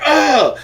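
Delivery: loud, high pained grunt. Retold or imitated. Zapped by the doctor's tool, a loud high grunt. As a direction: yelp as if zapped.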